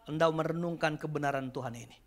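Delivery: ordinary talk, nothing marked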